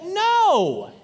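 high, loud exasperated no as the wife. Retold or imitated. Wife cutting off her husband, a high loud exasperated no. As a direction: blurt a sharp no, fed up. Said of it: "She's like".

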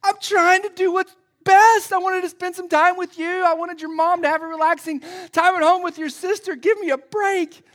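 high, loud exasperated outburst; yelling dad. Acted. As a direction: yell it back in hammed-up desperation. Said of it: "Like, I'm yelling".

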